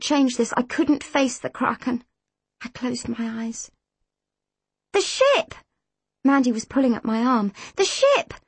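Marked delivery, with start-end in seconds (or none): 4.9-5.5 high-pitched cry of alarm; urgent, much higher pitch. A narrator's reading. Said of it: "she said over and over again". 7.8-8.3 high-pitched cry of alarm; urgent, much higher pitch. A narrator's reading. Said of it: "she said over and over again".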